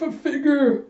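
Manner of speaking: very high, stammered call; panicked cry. Retold or imitated. York calls to Figure as the cyclone nears, panicked cry. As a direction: squeal the name in mock terror.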